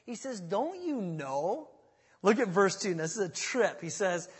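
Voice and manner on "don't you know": slow, drawn-out shaming question; shaming rebuke. Retold or imitated. Paul chiding the forgetful church, slow shaming question. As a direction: let them feel the shame, slowly. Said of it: "It's a shameful thing".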